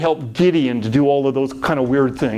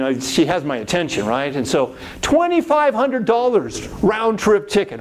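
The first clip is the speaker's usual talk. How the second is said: usual speaking voice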